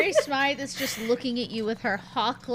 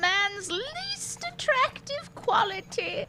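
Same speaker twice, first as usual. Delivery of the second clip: put-on higher, wry, knowing old woman; wry old lady; 9 semitones higher, 3 dB louder than usual. Mary Smythe wryly tells Vilma about men's names, higher voice. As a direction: deliver a knowing remark. Mary's worldly remark.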